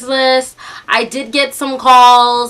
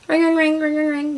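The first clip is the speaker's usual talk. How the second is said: high, soft imitated ringtone; light sing-out of a ringtone; phone ringing; 4 semitones higher, 6 dB quieter than usual.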